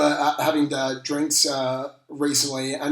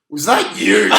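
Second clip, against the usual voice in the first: louder, laughing through the question; laughing recognition; 4 semitones higher, 11 dB louder, slower than usual. Retold or imitated. Footy legend realising who he told off, laughing. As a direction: laugh it out in amused disbelief.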